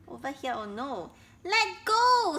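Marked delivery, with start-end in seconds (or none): 1.5-2.4 high, loud squeal. A narrator's reading. Elephant caught by crocodile, squealing. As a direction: squeal it out in protest. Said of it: "squealed elephant".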